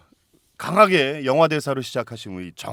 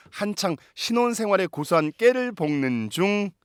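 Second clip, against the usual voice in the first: announcer voice; 4 semitones higher, 4 dB louder than usual. Acted. Host introduces a guest.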